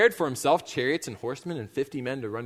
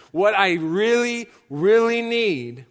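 both "really" drawn out, higher and louder; emphatic plea; 5 semitones higher, 5 dB louder, slower than usual. Acted. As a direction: build slowly and loudly on "really really need". Solomon's emphatic plea to God.

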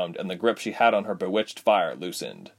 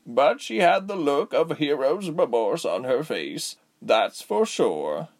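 put-on slow raspy old woman; 6 semitones higher, slower than usual.

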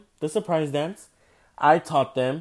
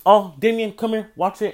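retold call, higher and louder at the start; calling over; 6 semitones higher, 7 dB louder than usual. Teacher beckons brother to the tablet, calling out. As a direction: call someone over, pitched up.

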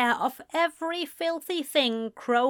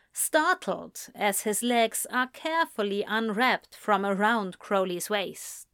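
ordinary delivery

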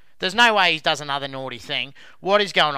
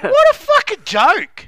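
slow, loud and much higher disgust; outraged; 9 dB louder, slower than usual. Real, not acted. Ranting about the players' apology video, loud outrage. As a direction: drag out every word in outrage.

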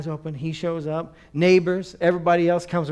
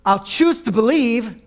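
loud, slow, raised pitch; emphatic declaration; 5 semitones higher, 9 dB louder, slower than usual. Shown as a demonstration. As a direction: proclaim it slowly and firmly. Preacher declares a stubborn choice.

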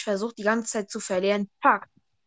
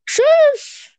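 much higher, loud mock tschüss; high squeaky goodbye; 10 dB louder than usual.

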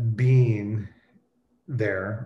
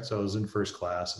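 ordinary talk

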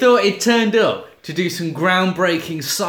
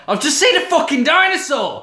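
high, loud retelling in alarm; mock frantic blurting; 11 semitones higher, 5 dB louder than usual. Kidnapped woman reporting a dinosaur, mock frantic. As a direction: blurt it out in exaggerated disbelief.

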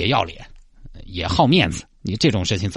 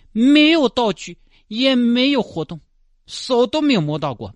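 high, slow wailing complaint; indignant high complaint; slower than usual. Acted. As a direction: wail the losses. Complaining about scam.

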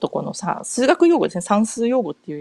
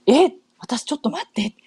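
acted startled reaction; 5 semitones higher than usual. Acts out her startled reaction.

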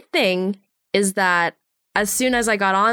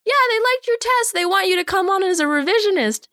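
high, quick, breezy; the producer casually telling her she got the job, breezy and high; 12 semitones higher, faster than usual. Retold or imitated. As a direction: toss off the good news lightly. Voicing the producer.

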